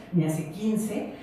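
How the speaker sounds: usual speaking voice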